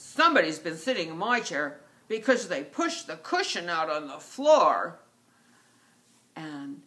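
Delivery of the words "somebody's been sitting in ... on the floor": big, loud bear bellow; giant huge bear. Acted. Giant bear finds his chair disturbed, loud bellow. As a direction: boom it out as Papa Bear. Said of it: "Giant, huge bear says".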